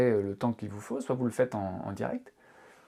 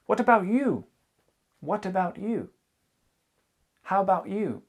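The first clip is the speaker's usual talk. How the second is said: higher, bright teacher demo of the question; modelled English question; 7 semitones higher than usual. Shown as a demonstration. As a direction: ask it back as if to a partner. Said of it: "posez la question à la personne". (question modèle, voix plus aiguë)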